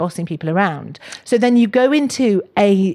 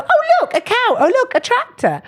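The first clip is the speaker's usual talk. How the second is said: put-on high, sing-song voice for a toddler; sing-song parent voice. Mum pointing out farm animals to small children, high and sing-song. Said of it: "get in the car with their colleagues and go".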